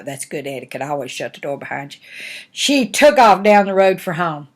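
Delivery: ordinary delivery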